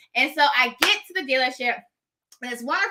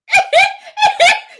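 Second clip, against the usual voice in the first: burst of laughter; 10 dB louder than usual. Real, not acted. Laughing at her own story.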